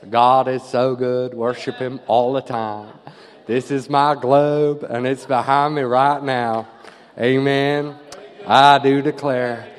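put-on slow American-accented preacher; best American accent. English preacher playing to the church, American accent. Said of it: "I'll do it in my best American accent".

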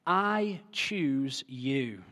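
very slow, quiet and deliberate; drawn-out gentle I choose you; tender declaration. Acted. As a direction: let each word land softly, I choose you. Preacher voices God choosing.

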